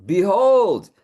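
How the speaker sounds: booming goddess entrance; proclaiming. Acted. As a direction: call it out like a goddess arriving. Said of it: "I wrote this book in her voice".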